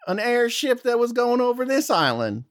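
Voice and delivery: high folksy guard voice; Southern guard. Acted. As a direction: question strangers like a suspicious Southern sentry. Guard questions.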